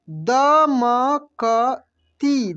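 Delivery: दमकती drawn out very slowly, each syllable separate. Shown as a demonstration. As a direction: sound out दमकती slowly for the class. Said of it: "कैसे पढ़ेंगे?". (दमकती को अक्षर अक्षर धीरे बोलिए)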